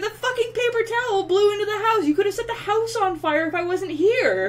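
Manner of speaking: high, loud scolding rant; angry scolding. Retold or imitated. Scolding roommate who nearly set the house ablaze. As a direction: chew out the roommate for the fire risk. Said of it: "I'm just like".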